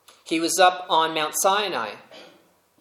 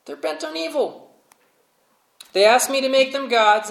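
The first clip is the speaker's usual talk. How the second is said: whiny excuse; 10 semitones higher, 5 dB louder than usual. Acted. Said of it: "he's like".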